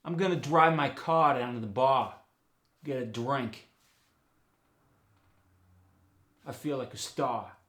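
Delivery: New York dropped R on CAR, BAR and STAR; New York accent. Shown as a demonstration. New Yorker off for a drink, in New York accent. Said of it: "goes to ah".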